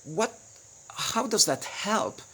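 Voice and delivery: high, slow, puzzled question; doubtful listener not getting the point; imitating a baffled listener. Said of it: "And so you go like".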